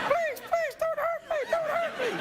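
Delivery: squeaky falsetto pleading; hammed-up terrified tie; begging tie. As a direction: plead in a tiny high voice. Host voices the begging tie.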